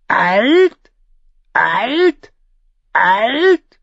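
high, squawking bird voice; raven voice. Acted. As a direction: croak it like a raven. Raven repeats the word.

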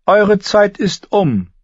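high, loud, slow royal decree; Cersei announcing. A narrator's reading. Cersei dismissing Barristan, high, slow and loud. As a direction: pronounce it like a queen dismissing him. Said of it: "verkündete Cersei Lannister".

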